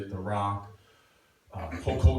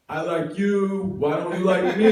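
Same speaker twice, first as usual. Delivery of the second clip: put-on drunk yelled confession; 9 semitones higher, 10 dB louder than usual. Drunk guy yelling his crush through the wall, loud and pleading. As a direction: bellow it at the wall, drunk and hopeful. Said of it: "I go".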